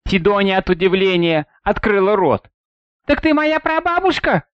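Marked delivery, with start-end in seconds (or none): 3.0-4.5 put-on high startled girl; surprised cry. A narrator's reading. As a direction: gasp it out in surprise. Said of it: "хотела выкрикнуть она".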